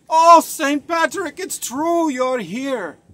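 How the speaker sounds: high, loud, slow gushing awe; excited greeting. Retold or imitated. Murphy meeting Saint Patrick, awestruck and gushing. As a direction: gush with delight at meeting a saint.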